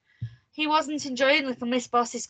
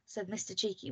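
usual speaking voice

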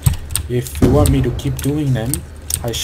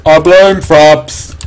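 slow, louder, stretched out; mock drawn-out repeat; 6 dB louder, much slower than usual. Player blaming Fraps for lag, mock drawn-out repeat. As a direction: draw every word out loudly.

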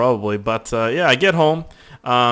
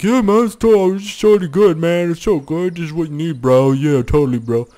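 higher, louder slurred dude, easing down; store guy; 5 semitones higher, 5 dB louder than usual. Retold or imitated. Store guy reassuring me about the GoPro, mock dude voice. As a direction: put on a mock bro salesman. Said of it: "He's like".

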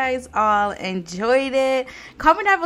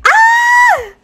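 short, loud high-pitched scream; excited scream; 13 dB louder than usual. Real, not acted. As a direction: scream in thrilled surprise. Startled delight at couple.

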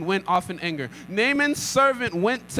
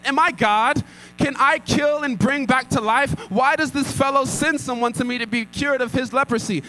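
higher and louder, frantic protest; alarmed outburst; 7 semitones higher, 4 dB louder than usual. Acted. King of Israel tearing his robes, frantic and loud. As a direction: throw the questions up in panic.

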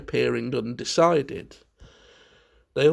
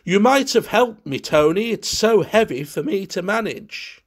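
higher, louder peevish whine; peevish call, voiced higher and louder; 7 semitones higher, 5 dB louder than usual. A narrator's reading. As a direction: complain like a put-upon child. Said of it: "calling out peevishly".

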